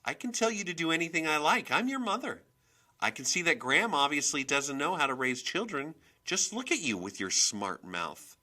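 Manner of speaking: loud, high-pitched retort; indignant mother pulling rank; bristling anger. A narrator's reading. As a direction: snap back, asserting authority. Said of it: "Skyla bristled".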